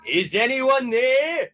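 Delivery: high loud yell. Acted. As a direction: yell out at the empty hall. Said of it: "yelled Mister Norris".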